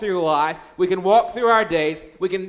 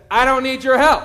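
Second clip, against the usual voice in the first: put-on higher, louder stubborn kid voice; defiant child; 6 semitones higher, 7 dB louder than usual. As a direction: snap it back like a stubborn seven-year-old. Son defiantly refuses dad's help.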